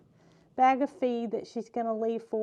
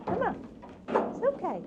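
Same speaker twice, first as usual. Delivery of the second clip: tender coaxing; 2 semitones higher than usual. Real, not acted. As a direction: coax the babies out gently. Coaxing final fawns.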